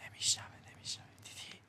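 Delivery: quick hushed whisper. Real, not acted. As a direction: whisper fast so nobody hears. Testing if NPC hears whispers.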